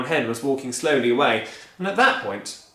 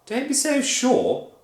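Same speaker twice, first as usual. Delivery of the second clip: higher, slow, sceptical; doubtful wife; 12 semitones higher, slower than usual. Acted. Missus Cranky doubting her husband, slow high warning. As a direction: warn him off. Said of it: "cried Missus Cranky".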